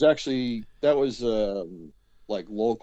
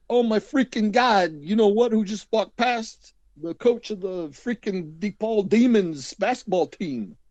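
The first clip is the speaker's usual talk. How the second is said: higher, slow, thrilled exclamation; excited family member; 9 semitones higher, 4 dB louder, slower than usual. Retold or imitated. Roommate's relative excited to spot the DePaul coach. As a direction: gush over the famous coach passing by. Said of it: "someone's like".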